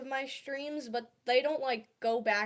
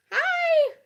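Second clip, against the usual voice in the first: excited greeting; 11 dB louder than usual. Real, not acted. Greeting viewers joining stream.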